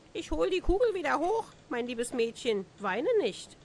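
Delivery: high, quick little frog voice, then settling. A narrator's reading. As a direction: chirp it up out of the well as a kindly frog. Frog answers from the well. (hohe, schnelle Froschstimme, die dann ruhiger wird)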